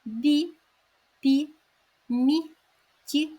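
"Бі, пі, мі, ті" as separate gentle soft syllables; soft, gentle consonants. Shown as a demonstration. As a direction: say "Бі, пі, мі, ті" tenderly, palatalised before і. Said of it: "м'якими, лагідними, ніжними. Ось послухайте".

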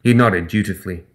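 ordinary delivery

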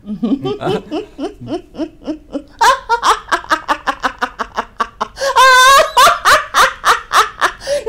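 wild, high, loud cackle; possessed oracle laugh. Shown as a demonstration. Artist demonstrates an oracle role from a radio play, wild cackling. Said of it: "ꯂꯥꯏꯕꯥꯎꯒꯨꯝꯕ".

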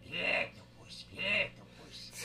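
drawn-out cartoon character voice; cartoon character warm-up. Shown as a demonstration. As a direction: stretch the word out in a put-on character voice. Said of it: "And I would just go".

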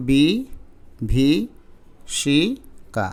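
slow, syllable by syllable, pausing between each; spelled out syllable by syllable. Shown as a demonstration. Teacher spelling the word slowly to students, syllable by syllable. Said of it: "এবার বানানটা দেখো".

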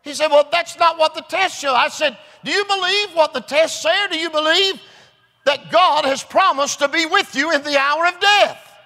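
high, loud, impassioned preacher shout; full-force preaching. Acted. Preacher assuring a dying man by scripture, shouted sermon. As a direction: build to long, drawn-out cries of scripture.

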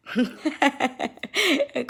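light bubbly run of giggles; laughing. Real, not acted. Chuckling at her own English studies, soft laughter. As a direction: laugh in a quick, soft string.

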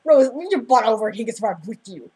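loud heckle at a film character; loud, exasperated retold rant; mock scolding. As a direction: berate the screen, hammed up. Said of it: "I'm like".

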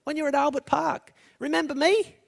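an eager, high-pitched fangirl cry; screaming out. Retold or imitated. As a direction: plead with the star to remember her. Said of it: "screaming out".

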